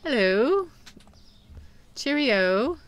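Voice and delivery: playful mock-British lilt, swooping up at the end; British accent. Shopkeeper greeting Batman's butler, mock British accent. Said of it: "work on my British accent".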